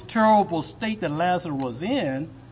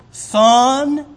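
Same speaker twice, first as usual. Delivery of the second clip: loud, higher solemn call; Abraham replying; 7 semitones higher, 8 dB louder than usual. Acted. Abraham answering the rich man, solemn loud call. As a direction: address him gravely as son. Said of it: "what Abraham is gonna say".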